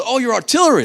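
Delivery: high, loud mock-French voice; bad French accent. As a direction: mistaken Frenchman, hammed up. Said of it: "my accent is just absolutely terrible".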